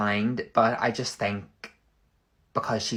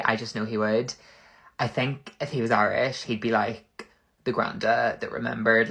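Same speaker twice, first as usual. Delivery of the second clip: ordinary talk, nothing marked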